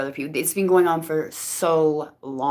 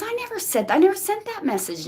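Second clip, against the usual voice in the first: much higher, insistent friend; imitated denial; 14 semitones higher than usual. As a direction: protest it like the wronged friend. Friend denies sending message.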